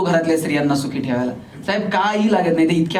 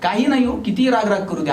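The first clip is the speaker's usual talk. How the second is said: usual speaking voice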